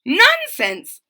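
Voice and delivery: high loud scornful snort. A narrator's reading. Captain brushing off the warning, loud snort. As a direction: scoff it off. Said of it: "snorted the captain".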